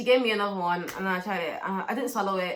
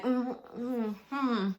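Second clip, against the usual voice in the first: closed-mouth hum; 2 semitones higher, 5 dB quieter, much slower than usual. Retold or imitated. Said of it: "I just greeted them like".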